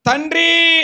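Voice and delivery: Jesus crying out to the Father; loud cry. A narrator's reading. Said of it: "బిగ్గరగా కేకవేసి".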